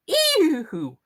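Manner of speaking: high squeal of disgust. Acted. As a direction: recoil from the goo. Tina sees plant guts everywhere.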